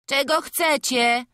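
loud, high, drawn-out reply; a touch of a woken boy calling back; lightly voiced drowsy whine, high and slow; sleepy youth. A narrator's reading. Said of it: "zaspany głos Frącka".

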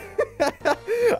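high burst of laughter. Real, not acted. Player amused by his own game, laughing. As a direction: crack up laughing.

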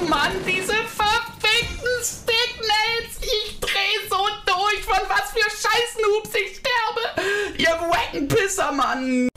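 screaming gamer rage, dragged out; furious shouting at the game; berserk rage. Real, not acted. Gamer raging after dying. (wütendes Ausrasten)

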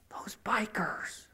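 hushed whisper, slow, conspiratorial; whispered aside. Retold or imitated. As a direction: whisper it like a dirty secret. Whispered as if taboo.

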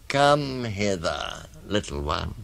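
slow, drawn-out beckoning; coaxing crocodile. Acted. Crocodile invites the child to come close, slow and coaxing. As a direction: lure them in, lingering on each word. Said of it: "said the crocodile".

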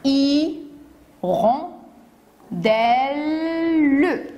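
"I, ron, del, le" stretched in four beats; syllable by syllable. Shown as a demonstration. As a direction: slowly spell out by sound "I, ron, del, le". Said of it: "Quatre syllabes".

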